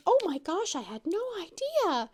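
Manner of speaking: high, put-on astonished voice; amazed realization. Retold lady's amazed realization.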